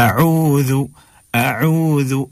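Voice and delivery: "अऊज़ु" with a clear throat ain and long oo; correct ain. Shown as a demonstration. Teacher correcting learners, "अऊज़ु" slow with ain audible. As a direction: draw out "अऊज़ु", sounding the ain. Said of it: "आइन गायब हो गया".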